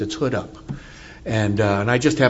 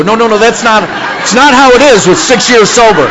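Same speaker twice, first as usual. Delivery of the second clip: much higher and louder, rushing protest; alarmed loud protest; 11 semitones higher, 13 dB louder than usual. Retold or imitated. Treatment center newcomers rushing him off stage, loud alarmed protest. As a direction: urgently stop him, voice way up.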